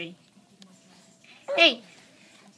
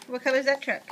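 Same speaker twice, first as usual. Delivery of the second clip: ordinary talk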